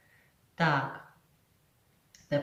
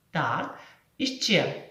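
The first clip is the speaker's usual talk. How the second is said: ordinary delivery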